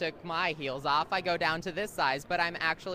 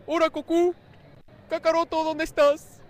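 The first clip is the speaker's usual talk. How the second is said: high, loud cartoon voice in Spanish; Spanish dub voice; 11 semitones higher, 11 dB louder than usual. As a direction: belt it like a Spanish cartoon hero. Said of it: "that Spanishness from you".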